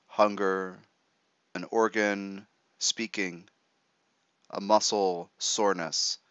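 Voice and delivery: ordinary talk, nothing marked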